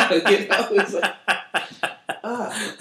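loud, high burst of laughter. Real, not acted. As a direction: crack up and talk through it. Laughs at story.

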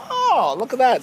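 high-pitched gloating; mock amazement. Real, not acted. Foreigner to American co-host on history, mock amazed. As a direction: crow over a point scored. Crowing over co-host's not knowing.

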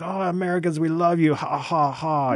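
high, cheery mock Italian voice, laughing ha ha. Old Italian man greeting American tourists, hammed-up Italian accent. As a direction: play a chill Italian man loving Americans. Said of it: "They're like".

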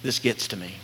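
low, hushed aside; soft, intimate confession; quietly moved. Real, not acted. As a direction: drop the voice right down, sincerely touched. Preacher moved before reading a quote.

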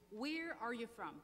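usual speaking voice

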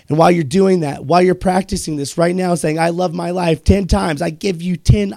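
loud, drill-like urging; rousing pep talk. Real, not acted. Host ordering listeners to practice self-love, loud rousing pep talk. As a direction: push it out forcefully like a motivational coach.

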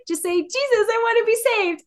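high, playful singsong; being silly. Real, not acted. Mom teasing her son about being saved, high and playful. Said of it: "I was just kind of being silly".

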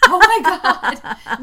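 high, loud thrilled shriek; excited shriek. Real, not acted. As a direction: shriek it with delight. Reacting to friend yawning.

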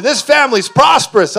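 loud, high proclamation, acted out; shouted declaration. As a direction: proclaim it over the household. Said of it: "I started shouting it in my house".

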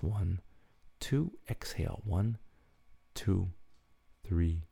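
slow, soft counted breathing; guided breathing. Acted. Host leading listeners through bedtime breathing, slow soft counting. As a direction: guide them calmly through each breath.

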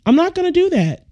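sudden high, fast refusal; high-pitched fast no way; exasperated refusal. Real, not acted. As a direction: squeal it out, fed up.

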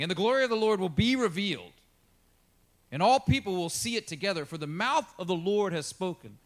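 loud, lifted proclamation; scripture reading. Acted. Pastor proclaiming the glory verse, loud and lifted. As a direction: ring it out to the back pew.

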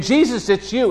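high, bright burst of recognition; joyful cry. Acted. Mary recognizing the risen Jesus, joyful cry. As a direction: cry out in sudden joy.

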